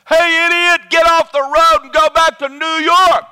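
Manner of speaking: high, loud furious yell at another driver; road rage yell. Retold or imitated. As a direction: bark it out at the idiot on the road. Retelling own road rage.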